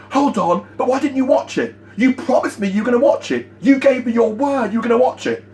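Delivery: mock a rep losing it and guilt-tripping; hammed-up needy nagging, pitched high; desperate whiny complaint. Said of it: "completely lose it without any posture".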